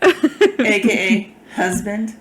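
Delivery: drawn-out playful demand; slow, teasing hint. Pretend.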